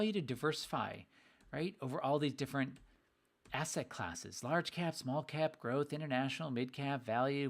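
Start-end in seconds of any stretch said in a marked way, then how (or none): none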